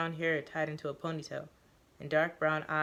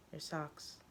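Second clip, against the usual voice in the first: soft curt command; 10 dB quieter than usual. Acted.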